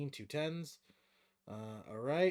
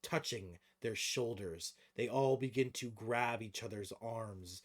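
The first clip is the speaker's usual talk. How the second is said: game master narration; 3 semitones higher, 7 dB louder, slower than usual. Acted. Game master describing creatures fusing for the players, dramatic narration. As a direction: narrate the monster's transformation, drawing out key words.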